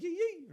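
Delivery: very high "yee, yee" cries; squealed little whoops; Mexican yips. Retold or imitated. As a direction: imitate a festive grito yip. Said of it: "one of those Mexican yips".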